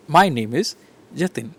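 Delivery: "My name is" then "Jatin" falling to a close; falling statement. Shown as a demonstration. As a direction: finish the sentence firmly down on "Jatin". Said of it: "I'm finishing my sentence here".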